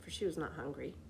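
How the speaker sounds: plain reading voice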